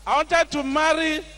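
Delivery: usual speaking voice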